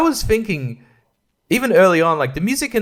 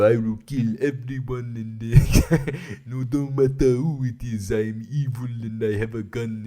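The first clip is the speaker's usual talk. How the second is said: Gary Oldman's Russian villain; 3 semitones lower, 4 dB quieter than usual. Acted. Russian terrorist gloating at Americans, hammy Russian accent. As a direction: drag out a menacing fake Russian accent. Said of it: "Gary Oldman, he's very like".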